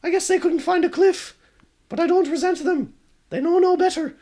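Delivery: the ghost shrugging off her fate; high wistful ghost girl. Acted. Ghost girl forgives them.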